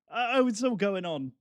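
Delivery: ordinary talk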